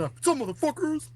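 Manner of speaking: very high-pitched mock podcast greeting; hammed-up squeal of a hype greeting; hyped host intro. Offering to greet Austin this way, hammed-up hype intro. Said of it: "Should I do that when we have Austin?".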